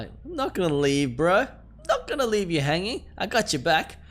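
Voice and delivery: high, swooping mock-tender reassurance; playful promise. Player promising the girl he won't leave, playful high-pitched reassurance.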